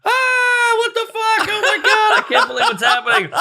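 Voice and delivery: high, amazed outcry that settles into a laugh; as if you can't believe your eyes; shocked disbelief. Retold or imitated. Retelling seeing the man roll on the cement, high shocked outcry. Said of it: "And I was like".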